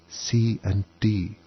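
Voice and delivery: soft, slow half-whispered letters. A narrator's reading. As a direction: breathe the letters timidly. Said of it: "she added in a whisper".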